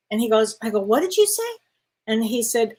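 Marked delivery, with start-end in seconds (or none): 0.8-1.4 mild high-pitched disbelief; acting out a startled "come again?"; retold startled question. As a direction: ask it high, as if taken aback. Retold startled reply to doctor.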